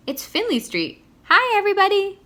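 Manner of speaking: high loud joyful greeting; shouting hello. Acted. Boy flying over his family, high joyful shout. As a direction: call down happily to the family. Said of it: "he shouted".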